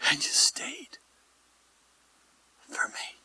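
near-silent whisper with long pause; hushed awe. Real, not acted. Pastor thanking Christ for staying, hushed whisper. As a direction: drop to an awed whisper. Awed prayer after the shouted taunt.

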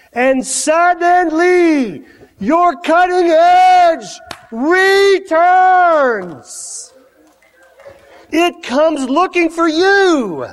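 high, slow, stretched-out proclamation; full-force preaching. Real, not acted. Preacher promising the congregation restoration, full-force high proclamation. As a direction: build to a ringing climax, every word drawn out.

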